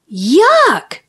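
high, falling yelp; mock cry of disgust. A narrator's reading. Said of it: "cried the whole class".

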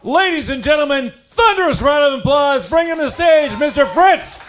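rousing MC introduction, name stretched out; stage announcer. Real, not acted. MC bringing a comic to the stage, announcer style.

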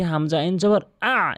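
usual speaking voice

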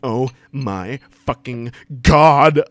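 slow, loud, building outcry; hammed-up mock scream. A narrator's reading. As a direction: space out each word and blast GOD. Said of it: "I screamed".

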